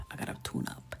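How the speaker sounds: whispered aside to herself; low whisper. Shown as a demonstration. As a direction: drop way down and whisper it.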